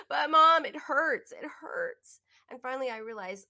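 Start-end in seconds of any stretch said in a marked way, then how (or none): 0.1-1.3 put-on loud, higher wail of a hurt child; mock a crying daughter's loud plea; imitating crying child. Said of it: "And she's crying". 1.3-2.2 breathy whispered whimper, higher; imitating a whimpering child. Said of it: "And she's crying".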